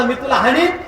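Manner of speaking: mock shout. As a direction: yell it for the guest to hear. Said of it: "तर ओरडायचं".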